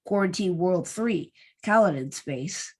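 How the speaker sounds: ordinary delivery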